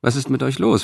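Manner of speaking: smiling question. A narrator's reading. Cicero to his stunned family, smiling. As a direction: ask it with a smile. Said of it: "fragte Cicero schließlich lächelnd".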